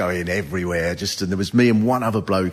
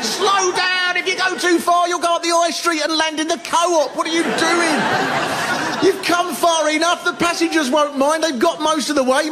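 panicked shouting. Retold or imitated. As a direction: yell at the captain in a total panic. Panicked imagined shouting at captain.

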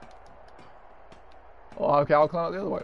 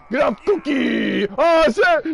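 high frantic shouting; panicked yelling; 10 semitones higher, 4 dB louder, slower than usual. Real, not acted. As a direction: yell louder as it goes wrong. Panicked yelling during game fight.

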